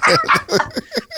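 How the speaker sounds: sudden high laugh; high burst of laughter. Real, not acted. Host laughing after teasing the guest, high laugh.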